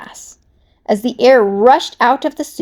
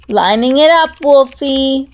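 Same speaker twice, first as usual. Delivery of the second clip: Webbs voice; 4 semitones higher, 6 dB louder than usual. Acted. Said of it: "Webbs told him".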